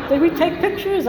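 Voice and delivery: high, laughing through the question; real laughter. Real, not acted. Woman laughing at her blacked-out wedding, laughing. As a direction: laugh off the lost memory.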